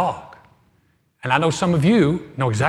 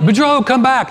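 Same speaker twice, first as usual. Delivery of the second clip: high, loud call after the dog; 9 semitones higher, 8 dB louder than usual. Retold or imitated. Owner calling Boudreaux back, loud and high. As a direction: shout after a runaway pet.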